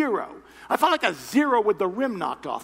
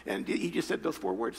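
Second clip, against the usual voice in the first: usual speaking voice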